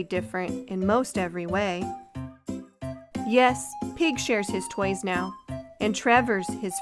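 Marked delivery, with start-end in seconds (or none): none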